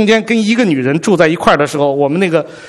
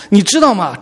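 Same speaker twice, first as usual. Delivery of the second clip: normal talking voice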